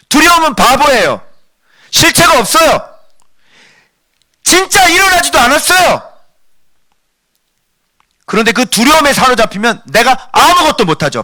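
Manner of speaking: high, insistent sermon voice; impassioned preacher at high pitch; forceful preaching. Real, not acted. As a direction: drive the questions home to the congregation. Preacher declares at full force.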